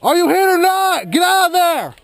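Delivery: urgent high yell; shouting. Real, not acted. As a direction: yell it across the field. Shouting to teammate in firefight.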